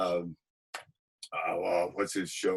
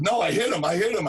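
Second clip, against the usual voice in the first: high, loud panicked repeat; alarmed; 9 semitones higher, 5 dB louder than usual. Retold or imitated. [name] realizing he really hit him, alarmed. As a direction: blurt it out in alarm, much higher.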